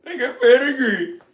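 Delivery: high mocking whine; mock breeder voice. Mocking breeders who brag about pedigree, high put-on whine. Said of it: "He started talking like that".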